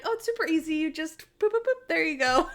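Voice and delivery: chirpy helpful woman who suspects nothing; high naive helper voice; innocent Natalie. Retold or imitated. Natalie fixes the bike chain.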